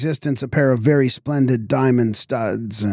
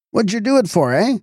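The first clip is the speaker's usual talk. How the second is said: higher and sharper, demanding; irritated demand; 10 semitones higher than usual. A narrator's reading. Constable Winch repeats his question to Fotheringay, more irritated. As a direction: press him harder for an answer.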